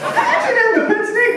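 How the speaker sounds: high, laughing call-outs; mock Nigerian guy. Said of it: "a Nigerian guy's like".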